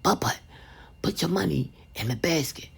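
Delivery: soft hushed mom in church; hushed instruction. Retold or imitated. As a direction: murmur it so nobody hears. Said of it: "I say".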